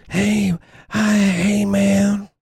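high-pitched Cleveland burnout guy accent. Shown as a demonstration. Demonstrating the strange accent to a friend, high Cleveland burnout voice. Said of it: "everyone talks like that".

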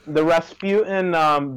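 ordinary talk, nothing marked

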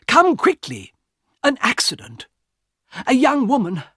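a frightened passenger begging for help; urgent Mister Harris. Acted. Harris urgently calls the guard.

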